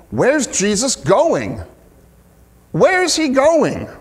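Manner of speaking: high, drawn-out mock bewilderment; puzzled questioning. The people asking where Jesus is going, imitated puzzlement. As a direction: imitate a baffled crowd, pitch jumping up.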